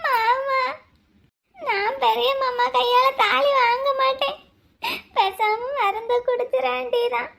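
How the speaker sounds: soft, high, slow tearful voice; crying out her despair softly; a broken, tearful bride near sobs; weeping. Acted. Bride weeps before the wedding.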